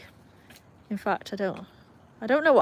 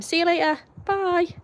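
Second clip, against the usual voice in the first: sing-song goodbye; 9 semitones higher than usual. Real, not acted. Final goodbye to viewers.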